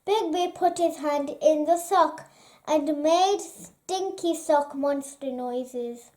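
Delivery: ordinary delivery, nothing marked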